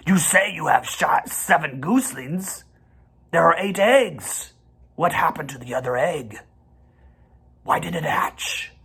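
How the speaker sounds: lightly voiced sharp rat; a hint of a sneering rat. A narrator's reading. Templeton questions the goose in a sharp voice. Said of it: "he began in his sharp voice".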